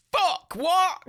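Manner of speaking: high, loud startled cry of disbelief; a loud high what then a laugh; shocked outburst. Real, not acted. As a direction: blurt it out in real shock. Sudden shock at a casting fact.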